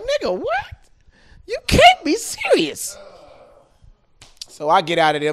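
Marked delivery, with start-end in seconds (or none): 0.0-3.0 high incredulous squeal; shocked, high-pitched disbelief. Retold or imitated. He reacts to the counselor's confession, shocked and high. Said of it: "I'm like".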